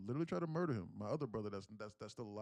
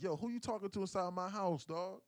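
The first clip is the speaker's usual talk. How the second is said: mock indignant challenge, higher pitched; street-style confrontational comeback; retold confrontation; 7 semitones higher than usual. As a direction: square up over whose house it is. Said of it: "my brother was like".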